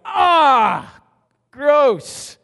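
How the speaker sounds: loud, high shout of disgust. Shown as a demonstration. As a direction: recoil from something revolting. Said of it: "It's, it's gross".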